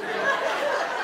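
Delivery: breathy chuckle; soft laugh. Real, not acted. Preacher laughing at his own story, softly. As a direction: laugh quietly at the memory.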